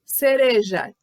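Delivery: ordinary talk, nothing marked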